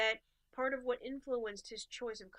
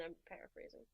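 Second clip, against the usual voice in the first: ordinary talk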